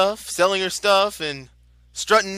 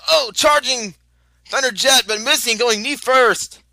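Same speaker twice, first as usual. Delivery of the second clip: excited; 4 semitones higher, 4 dB louder than usual. Real, not acted. Excited call of a charge.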